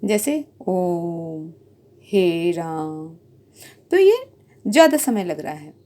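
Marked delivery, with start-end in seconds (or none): 0.0-3.1 very slow, ओम and राम drawn out to triple length; prolonged vowels. Shown as a demonstration. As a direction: hold the vowels in ओम and राम long like a chant. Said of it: "प्लुत स्वर में हमें तीन गुना समय".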